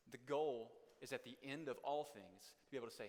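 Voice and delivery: ordinary talk, nothing marked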